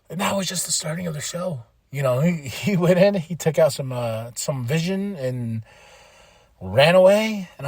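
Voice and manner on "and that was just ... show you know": normal talking voice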